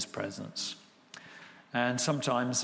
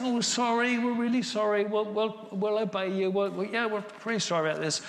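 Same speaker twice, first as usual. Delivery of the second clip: put-on higher, sing-along chorus of sorry children; hammed-up grovelling in a higher voice; mock contrite apology; 8 semitones higher than usual. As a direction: put on a high, over-eager apology. Children of Israel pleading apologies.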